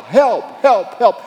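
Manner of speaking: desperate repeated shout for help; calling for help. Acted. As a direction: yell for help as if drowning. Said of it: "you've been calling for help".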